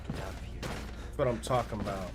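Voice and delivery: ordinary talk, nothing marked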